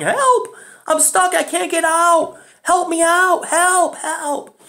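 put-on high, pleading child's cry from far off; little boy calling for help. Lost boy in the tall grass calls to strangers, high and pleading. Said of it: "a sound of a boy coming from the field of tall grass saying".

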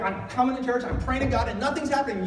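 a churchgoer venting frustration; frustrated complaint. Retold or imitated. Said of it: "And you're like".

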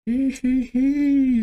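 high squeaky giggle; high-pitched hee-hee cackle; cartoon laugh. Real, not acted. Gleeful laugh at big play.

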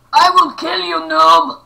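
put-on loud old man voice shouting. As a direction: bark it like a cranky old man. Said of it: "[name]'s doing the old man voice".

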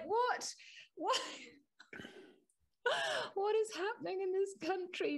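high, incredulous cries with a laugh; high exasperated what; exasperated disbelief. Real, not acted. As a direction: throw up your hands in high disbelief. Exasperated outburst to co-host.

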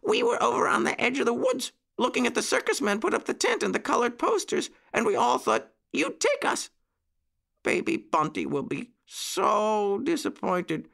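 high-pitched little voice; pleading puppy boy. A narrator's reading. Jackie says Baby Bunty will be disappointed, high pleading puppy voice. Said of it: "said Jackie".

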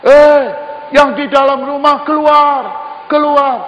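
loud, high call from outside the house; shouting. Retold or imitated. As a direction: yell for them to come out. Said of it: "dari luar rumah teriak".

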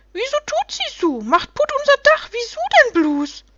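very high, loud complaining gnome voice; little creatures joining in. A narrator's reading. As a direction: pipe up as a whole band of aggrieved little creatures. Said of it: "stimmten die anderen ein".

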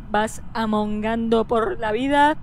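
ordinary talk

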